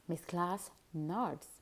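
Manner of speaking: usual speaking voice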